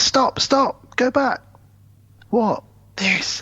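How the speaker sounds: urgent stop. Retold or imitated. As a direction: shout stop as the car catches his eye. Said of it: "And Arnie says".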